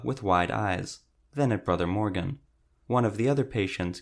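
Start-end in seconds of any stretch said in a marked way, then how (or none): none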